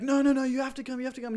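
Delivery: no, no, no, keen mock insisting; insistent brother. Brother urging Nate to come drink, insistent and excited. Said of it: "he's like".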